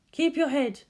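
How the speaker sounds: a cool word of advice to the pilot; advising calmly. Acted. Ritsuko coaching Shinji through the simulation, steady. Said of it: "Ritsuko advised".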